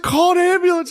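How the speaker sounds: high panicked shout; frantic order. Retold or imitated. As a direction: yell it in a panic. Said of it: "She was like".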